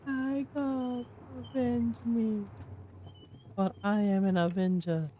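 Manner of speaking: high, slow theatrical dying plea; overacted death scene. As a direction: plead melodramatically to the heavens. Voices the dying unit.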